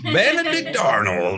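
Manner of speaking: slow, drawn-out American accent, lightly put on; mock American accent. Co-host echoing the name in a lightly put-on American accent. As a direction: answer in a hammy American accent. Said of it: "Hence the accents".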